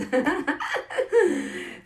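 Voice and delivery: high burst of laughter. Real, not acted. Laughing after her own reply to the doctor, high laugh.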